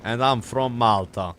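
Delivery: slow, loud Maltese cop voice; broken English, Maltese accent. Pretend. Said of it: "that's like a Maltese accent".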